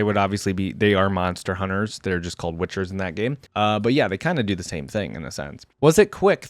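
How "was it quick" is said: high, louder distressed widow, lightly acted; understated tearful plea, pitched up; tearful question. A narrator's reading. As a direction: ask it urgently with a mild mock sob. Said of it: "the widow asked through sobs".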